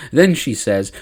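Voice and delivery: ordinary talk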